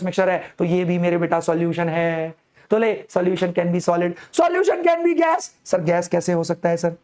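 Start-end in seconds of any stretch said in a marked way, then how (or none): 4.4-5.4 teacher springs the big reveal on students, high and slow; dramatic reveal, high and slow. Acted. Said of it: "teacher punches key line".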